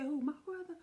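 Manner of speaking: high disbelieving cry; the sister in shock, high and loud; shocked question. Retold or imitated. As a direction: ask it in shock. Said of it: "She said".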